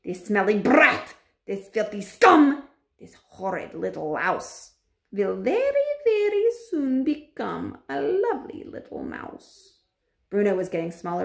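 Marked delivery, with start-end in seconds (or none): 0.0-2.7 slow, loud witch abuse; shouted insults. A narrator's reading. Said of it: "shouted out". 3.0-9.8 slow witch voice, rising high and sweet; gloating witch. A narrator's reading. Grand High Witch predicting the boy becomes a mouse, gloating.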